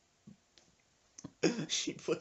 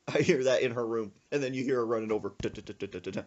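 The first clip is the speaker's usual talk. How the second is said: usual speaking voice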